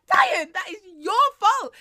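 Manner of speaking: half-laughing, call out the blame; mock blaming shout. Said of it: "And I was like".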